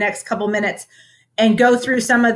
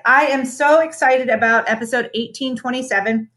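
ordinary talk, nothing marked

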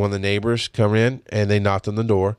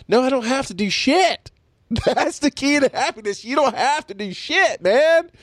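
much higher, gleeful voice; happy; 13 semitones higher than usual. Real, not acted. Telling the listener about freedom, gleeful and high. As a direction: laugh it out with delight. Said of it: "You hear the happiness in my voice".

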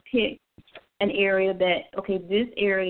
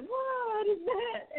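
startled cry; 13 semitones higher, 5 dB quieter, much slower than usual. Retold or imitated. Startled in the shower.

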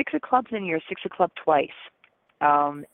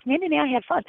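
higher, lightly voiced mischievous protest; cheeky monkey voice; 8 semitones higher, faster than usual. Monkey side answering the stately lion, higher cheeky protest. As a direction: play the naughty monkey defending itself. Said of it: "And the monkey goes".

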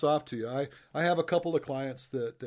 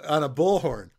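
ordinary delivery

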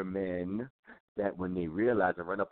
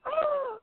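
very high imitated scream; sudden high-pitched yelp; mock scream. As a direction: squeal as if slapped. Mimes reaction to a slap.

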